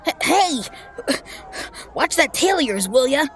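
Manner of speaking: high flustered protest. Acted. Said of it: "in a flustered blush".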